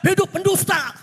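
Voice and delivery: high-pitched mock rallying cry; imitated cheer. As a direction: hail it like a crowd at a rally. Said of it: "Eh, dia teriak".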